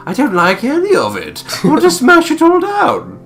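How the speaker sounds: rising mock squeal. Mocking the buyer's plans, high loud squeal. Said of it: "you're like".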